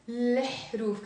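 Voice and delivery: الحروف said as le-hruf; flipped article. Shown as a demonstration. Teacher showing the flipped pronunciation, le at the start. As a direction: start الحروف on the l, not on a vowel. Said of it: "flipping the pronunciation around".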